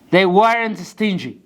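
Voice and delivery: slow, careful dictation pace; slow model sentence. Shown as a demonstration. Teacher dictating example sentence slowly. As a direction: spell it out slowly for the class. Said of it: "teacher models sentence slowly".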